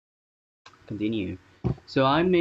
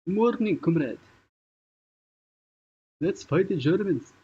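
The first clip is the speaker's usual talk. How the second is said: hammed-up Russian comrade accent, pitched up; Russian soldier accent; 8 semitones higher than usual. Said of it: "Russian accent".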